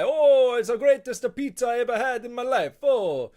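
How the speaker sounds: loud, high, exaggerated Italian accent; hammed-up Italian accent, shouting; Italian guy impression. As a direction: rave about the pizza like a cartoon Italian. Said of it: "It's like an Italian guy".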